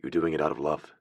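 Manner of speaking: low, soft, reassuring man; quiet, deep comfort; deep-voiced man. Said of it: "his deep".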